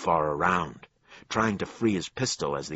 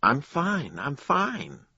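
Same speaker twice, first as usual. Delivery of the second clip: high, thin squeak; squeaky; 11 semitones higher, 4 dB louder, slower than usual. A narrator's reading. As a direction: squeak it out, embarrassed. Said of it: "Becker squeaked".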